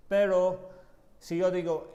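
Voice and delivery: ordinary talk, nothing marked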